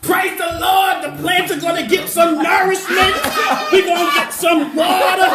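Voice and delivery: mock church testimony at full voice; hammed-up preacher shouting. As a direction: shout it like a jubilant revival preacher. Hammed-up church testimony about rain.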